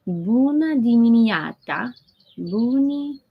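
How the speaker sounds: attempting Romanian greeting with Italian-French flair; mock Italian-French accent. Shown as a demonstration. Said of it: "part Italian, part French accent".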